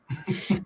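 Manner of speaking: a quick amused laugh; short laugh. Real, not acted. Guest laughing at the son's question to him. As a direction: let a brief laugh slip out. Laugh after kid's quote.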